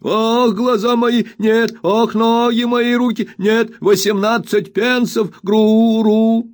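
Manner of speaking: put-on high, slow, whining old miser's wail; spiteful whining old man. A narrator's reading. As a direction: whine it out spitefully like a crazed old junk dealer. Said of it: "произносил нараспев".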